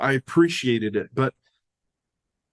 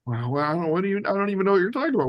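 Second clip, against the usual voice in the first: higher, rushed stammering bluster; flustered denial; 7 semitones higher, much faster than usual. Retold or imitated. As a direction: play caught out, babble innocence in a hurry. Caught stealing, stammering denial to a voice.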